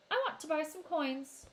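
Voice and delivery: lightly voiced older brother; steady, businesslike boy; boy's voice. A narrator's reading. Narrator voices brother Travis.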